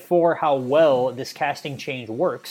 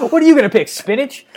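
mocking tease; 11 semitones higher, 7 dB louder, faster than usual. Real, not acted. Teasing co-host about snack choice.

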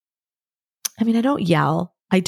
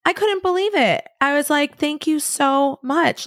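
high, drawn-out wonder; touched amazement; 8 semitones higher, slower than usual. Real, not acted. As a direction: marvel in a much higher voice, stretching the words. Amazed by child's apology.

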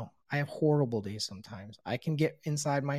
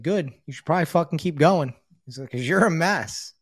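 loud, higher blunt sponsor voice; blunt verdict; 5 semitones higher, 11 dB louder than usual. Retold or imitated. Sponsor bluntly telling him he's a mess, loud. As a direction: hammer home the point.

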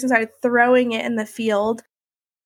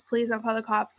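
much softer, begging please; softly begging the doctor not to call the police; quiet plea; 7 dB quieter than usual. Retold or imitated. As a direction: drop to a near-hushed plea.

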